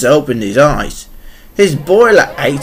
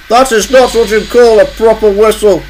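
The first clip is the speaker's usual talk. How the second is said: sniggering; 7 semitones higher, 4 dB louder than usual. A narrator's reading. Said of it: "he sniggered".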